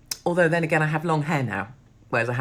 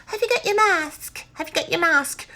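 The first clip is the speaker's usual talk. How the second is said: much higher, squeaky nagging shop-worker voice; mocking counter woman. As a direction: mimic the fussy counter woman demanding a mask. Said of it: "The woman behind the counter goes".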